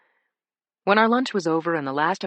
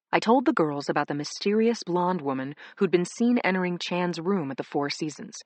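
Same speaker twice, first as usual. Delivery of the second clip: ordinary delivery, nothing marked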